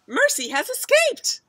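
high, loud call; alarmed mother. A narrator's reading. Mother spots the pig leaving, high alarmed cry. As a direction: cry out to point it out.

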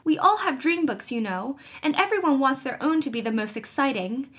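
high, tumbling, alarmed rush; incoherent excitement. Acted. Felicity confessing to her mother, excited and alarmed. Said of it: "said Felicity incoherently".

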